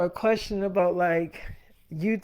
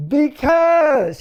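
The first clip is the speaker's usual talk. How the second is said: louder, higher, blurted excuse; defensive because; 5 semitones higher, 7 dB louder than usual. Retold or imitated. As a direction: snap out a lame excuse. Cornered boyfriend answering girlfriend.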